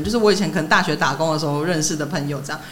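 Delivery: usual speaking voice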